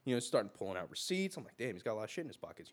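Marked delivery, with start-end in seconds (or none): 1.6-2.7 quick low murmur to self; muttered thought. Retold or imitated. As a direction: mutter it fast under your breath. Inner thought on the bus.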